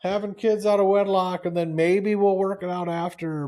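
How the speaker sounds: sarcastic comeback to the couple, pitched high; sarcastic retort. Retold or imitated. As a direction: fire back with put-on high cheer. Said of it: "You're like".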